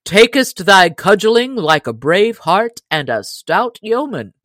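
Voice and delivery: usual speaking voice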